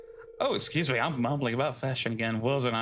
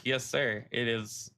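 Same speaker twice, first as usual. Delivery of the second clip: ordinary delivery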